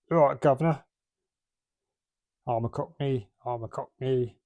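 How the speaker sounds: a light Cockney put-on, only lightly voiced; a quick, half-hearted Cockney impression; Cockney accent. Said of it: "they were all Cockneys".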